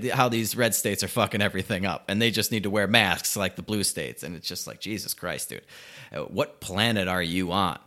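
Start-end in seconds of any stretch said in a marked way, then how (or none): none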